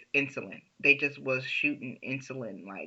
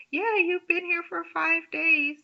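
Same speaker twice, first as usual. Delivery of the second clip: sweet nurse voice; 14 semitones higher than usual. Retold or imitated. Nurse breaking news sweetly to her waking patient, in a much higher coo.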